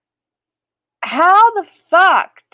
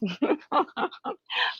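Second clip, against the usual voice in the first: laugh; 5 semitones higher, 11 dB quieter than usual. Real, not acted. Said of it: "I'm just kidding".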